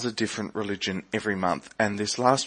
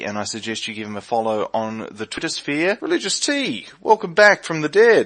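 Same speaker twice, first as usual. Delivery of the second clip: ordinary talk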